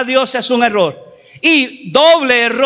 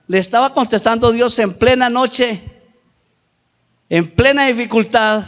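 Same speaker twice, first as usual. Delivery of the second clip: normal talking voice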